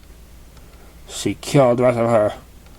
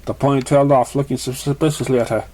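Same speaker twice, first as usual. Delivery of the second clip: ordinary delivery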